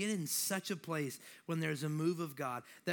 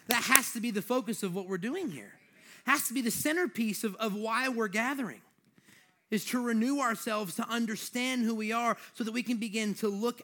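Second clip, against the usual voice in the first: higher, louder, emphatic preacher's voice; forceful preaching; 6 semitones higher, 5 dB louder than usual. Real, not acted. Preacher tells church to focus on Jesus, forceful preaching. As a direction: drive the point home to the congregation.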